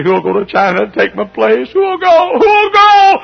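climbing to a high, loud wail; beg, rising into a cry; imitated crying plea. Old missionary begging a crowd to replace him, acted wailing plea. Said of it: "he got to crying".

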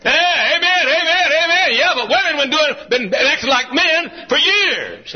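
high, drawn-out amens into a punchy complaint; fired-up preaching. Real, not acted.

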